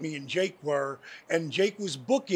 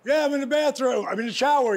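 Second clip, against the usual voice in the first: loud reply from another room; calling back; 7 semitones higher, 5 dB louder, faster than usual. Retold or imitated. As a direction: shout back from the shower. Answering from the shower.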